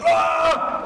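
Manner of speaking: short sharp high yell; battle cry. Shown as a demonstration. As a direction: yell like charging with a knife. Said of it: "seinen Kampfschrei macht".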